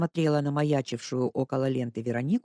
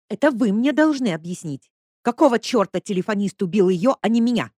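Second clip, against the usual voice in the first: higher and louder, outraged; Lesya, indignant, shouting at the investigator; indignant outburst; 6 semitones higher, 6 dB louder than usual. A narrator's reading. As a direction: demand an answer.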